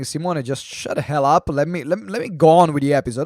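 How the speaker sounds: loud, fast impatient voice; annoyed listener. Pretend. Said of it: "I can hear you saying".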